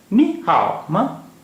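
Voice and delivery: नी हाओ माँ slowly, starting high, tones swooping; Mandarin model pronunciation. Shown as a demonstration. Teacher repeats Mandarin question slowly.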